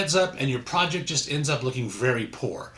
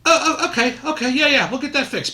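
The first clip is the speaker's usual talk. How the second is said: laughing; 11 semitones higher, 6 dB louder than usual. Real, not acted. Laughing flustered reply.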